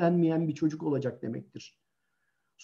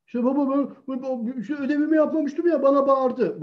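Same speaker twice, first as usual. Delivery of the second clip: put-on child explaining; 8 semitones higher than usual. Child explaining, voiced higher.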